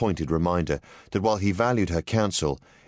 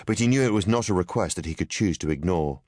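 ordinary delivery, nothing marked

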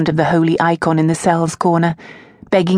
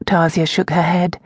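ordinary delivery

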